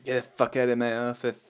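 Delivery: put-on barking it out in an Irish accent. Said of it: "your Irish accent?".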